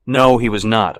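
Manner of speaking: loud irritated no; sharp loud denial. Acted. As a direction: shut her down loudly. Patrick snapping back.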